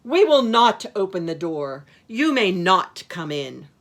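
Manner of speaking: put-on loud, emphatic kids' refusal; firm refusal. A narrator's reading. Brother and Sister Kid bar the wolf, loud firm voice. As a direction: refuse loudly, hitting each NOT.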